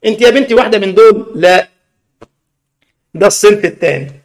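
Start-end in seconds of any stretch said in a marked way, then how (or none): none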